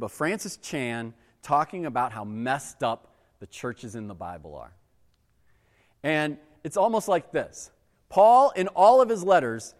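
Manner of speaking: ordinary talk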